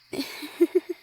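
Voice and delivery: short rising laugh at their fluster; amused laugh. Acted. Merchant laughing at the flustered adventurer, amused laugh. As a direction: laugh at the blushing friend.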